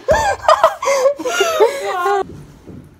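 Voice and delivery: high shrieking laughter; loud laughter. Real, not acted. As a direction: burst out laughing. Teased by friends.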